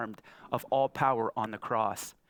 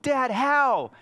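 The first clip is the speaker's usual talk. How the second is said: put-on high, loud exasperated child; frustrated child's cry; 11 semitones higher, 8 dB louder than usual. Daughter crying out to her dad, high and loud. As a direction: wail it like a fed-up six-year-old. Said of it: "She said".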